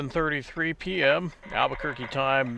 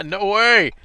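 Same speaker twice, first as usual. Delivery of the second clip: laughing exclaim; 8 semitones higher, 14 dB louder than usual. Real, not acted. Laughing reply to runner's remark.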